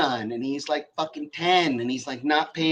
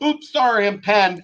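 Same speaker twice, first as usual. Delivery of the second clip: lightly voiced child, higher and louder; a hint of a sheepish ten-year-old; kid voice; 5 semitones higher, 5 dB louder than usual. Ten-year-old stepson answers.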